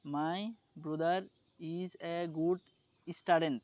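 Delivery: slow word-by-word "My brother is a good student" in learner's English; halting "My brother is a good student", brother as "broo-dar", student as "istudent"; imitated misreading. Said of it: "এটা বললো আর কি ধরেন".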